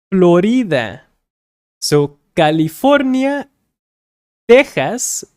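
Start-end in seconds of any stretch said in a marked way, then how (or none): 4.5-5.2 "Texas" with the X said as a breathy H sound; Spanish X as H. Shown as a demonstration. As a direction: voice the X in "Texas" like a throaty H. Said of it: "remember the X sounds like H".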